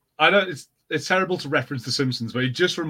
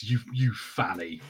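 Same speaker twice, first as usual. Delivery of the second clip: slow, mild mock Scottish jab, a little lower and softer; mock insult; 4 semitones lower, 5 dB quieter, slower than usual. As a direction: tease someone as a fanny, slowly and softly. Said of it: "calling someone a fanny".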